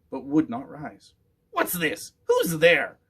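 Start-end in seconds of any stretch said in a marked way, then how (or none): 1.5-3.0 high, loud witch shriek; startled witch shrieking at an intruder. A narrator's reading. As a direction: witch shrieking at the unseen rider. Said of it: "shrieked Grimilda".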